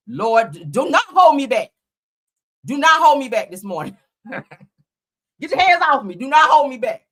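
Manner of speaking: loud hammed-up plea to be let go; mock held-back outburst. Vlogger pretending friends restrain her from fighting, mock loud. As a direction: pretend friends are holding you back, and shout.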